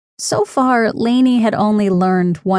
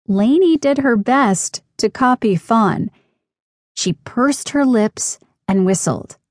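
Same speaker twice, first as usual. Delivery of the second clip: usual speaking voice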